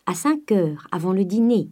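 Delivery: usual speaking voice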